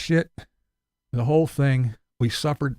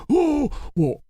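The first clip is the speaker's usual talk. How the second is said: high mocking know-it-all voice; higher, sneering impression of someone giving retirement advice; mocking commenters; 12 semitones higher than usual. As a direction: parody the smug commenter in a higher voice. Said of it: "people come over here and go".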